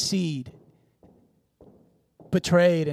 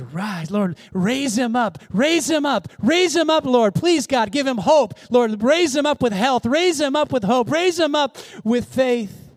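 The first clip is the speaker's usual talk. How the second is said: building to high, insistent pleading; fervent retold prayer; 6 semitones higher, 3 dB louder than usual. Woman praying over the preacher, fervent and rising. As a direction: pray it harder with each repetition.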